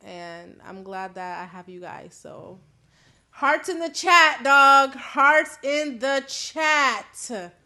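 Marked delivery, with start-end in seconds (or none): 3.3-4.9 loud call-out. Real, not acted. Asks viewers for hearts. 4.9-7.5 Hearts in the chat stretched very slowly; long drawn-out begging Hearts in the chat; slow drawn-out plea. Real, not acted. Repeats request to chat.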